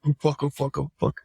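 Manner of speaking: panicked muttering. Retold or imitated. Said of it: "I was just flipping out".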